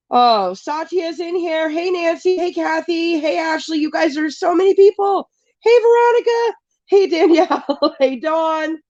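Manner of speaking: high, happy roll-call of hellos; excited greetings. Real, not acted. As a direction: greet each arriving friend with delight. Greeting many arriving viewers by name.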